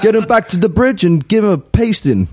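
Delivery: hammed-up New Zealand accent; requested Kiwi version. Guest redoing his prediction for the host, mock Kiwi accent. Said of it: "can we have the Kiwi version".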